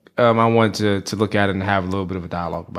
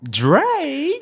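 high-pitched. Acted. Repeated call, second much higher.

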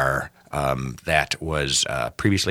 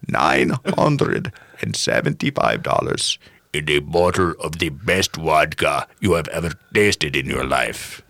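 put-on slow, lower Russian accent, sly final offer; 3 semitones lower, slower than usual. Old Russian buyer sweetens the deal with vodka in a Russian accent. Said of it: "Your, your Russian American accent was on point".

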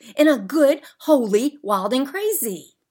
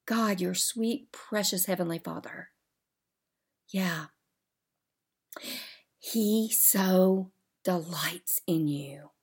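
soft, whispered prayer to the Father; sinking into a whispered prayer of devotion; hushed prayer; 3 semitones lower, 8 dB quieter than usual. Real, not acted. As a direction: drop to a reverent whisper. Tender prayer to God.

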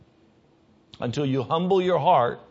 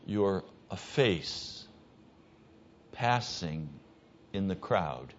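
hushed and slow; 6 semitones lower, 8 dB quieter, slower than usual. Acted. Preacher slows for effect.

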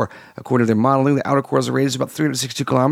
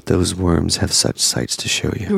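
low, slow sinister villain; deep menacing horror voice; 8 semitones lower, slower than usual. Pretend. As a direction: growl it out like a horror demon. Hellraiser quote joke.

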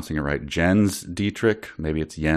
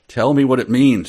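higher and louder demand; yelling; 5 semitones higher, 6 dB louder, faster than usual. Acted. Susan yells at him to explain, higher and louder. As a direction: yell the question at him. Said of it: "she yelled".

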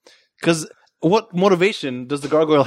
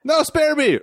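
high-pitched mock plea; pleading victim; 12 semitones higher, slower than usual. Guy begging the gargoyle to spare him, high mock-terrified plea. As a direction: beg for your life, pitched way up.

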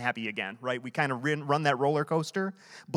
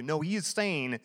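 ordinary delivery

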